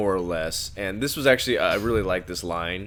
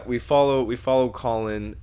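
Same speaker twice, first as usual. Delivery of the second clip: ordinary talk, nothing marked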